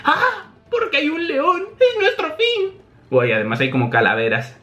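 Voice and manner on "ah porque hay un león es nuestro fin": very high falsetto panic; acted doomed-heroine shriek; terrified. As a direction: wail in fright at a lion. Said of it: "completamente cagada".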